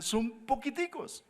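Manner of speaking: very high, slow little voice; tiny-voiced protest. Doubter answering that the army is small, tiny-voiced. Uno objeta que son pocos.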